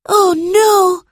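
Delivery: sudden fear for a friend; alarmed cry. A narrator's reading. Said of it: "cried George".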